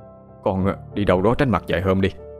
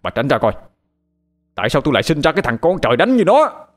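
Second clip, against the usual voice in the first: loud, higher furious scolding; angry father; 6 semitones higher, 5 dB louder, faster than usual. Acted. Father threatens to call the police on his son, furious shouting. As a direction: lash out at the son, fast and loud.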